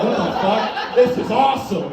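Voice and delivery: high-pitched acted trippy delight; delighted amazement. Acting out delight at bears rubbing him.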